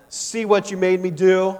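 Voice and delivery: acted exasperated mom; accusing angry mother. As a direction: scold the child as if it's all their fault. Angry mother blames child.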